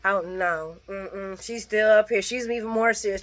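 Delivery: normal talking voice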